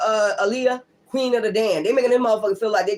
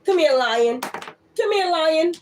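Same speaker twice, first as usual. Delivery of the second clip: calling a pet; 8 semitones higher, much slower than usual. Real, not acted. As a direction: coax the pet over in a high, cooing voice. Calling her pet over.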